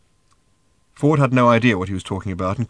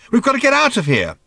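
urgent; 7 dB louder than usual. Acted. Ford urgently pressing Arthur.

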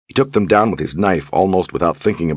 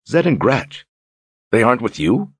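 surprised; 6 semitones higher than usual. Acted. Shocked they are missing.

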